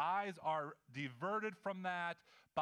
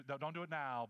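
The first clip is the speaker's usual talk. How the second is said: ordinary talk, nothing marked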